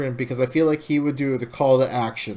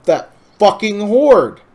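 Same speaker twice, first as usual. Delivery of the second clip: loud, raised, angry rallying shout; furious war cry; 7 semitones higher, 8 dB louder than usual. Retold or imitated. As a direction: bellow it like a king declaring war. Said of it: "Varian saying".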